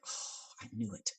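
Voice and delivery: slow hushed whisper to himself; whispered aside. Retold or imitated. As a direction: breathe it out slowly, knowingly. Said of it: "I'm like".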